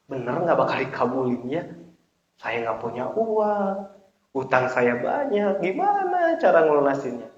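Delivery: mock fretful, soft questioning; doubtful worrying. Preacher imitates worshipper worrying over debts, doubtful. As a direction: voice someone unsure and weighed down by debts.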